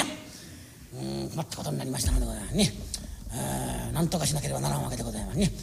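low, soft and quick asides; musing aside. A narrator's reading. Storyteller whispering a rueful aside to the audience. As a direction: chat confidentially to the audience. Narrator muses meta aside.

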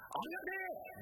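high, sudden cry of gratitude; blurted thanks. Retold or imitated. A theatregoer blurts out thanks at the play, high and sudden. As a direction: let it burst out before you can stop it.

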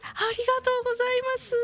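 very high, rapid little thank-you; squeaky high thanks. Acted. Host thanking the listener for her first message, squeaky high and fast. Host's second thanks, put-on voice.

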